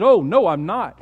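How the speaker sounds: loud, heated denial. Acted. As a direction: deny it with rising heat. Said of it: "He said".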